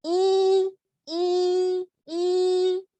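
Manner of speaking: I held high and level; first tone. Shown as a demonstration. Teacher showing first tone, i high and even. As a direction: sustain each "i" on one high note. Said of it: "Primer tono".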